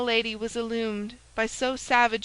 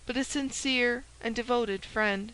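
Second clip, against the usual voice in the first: usual speaking voice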